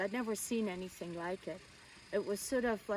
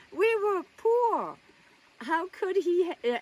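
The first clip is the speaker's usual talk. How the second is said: incredulous exclamation; 9 semitones higher, 8 dB louder than usual. Real, not acted. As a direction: cry out in sheer disbelief. Exclaims over father keeping a mistress.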